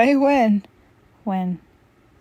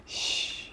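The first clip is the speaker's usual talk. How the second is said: gasp and shush; 13 dB quieter than usual. Acted. Gasp then hush.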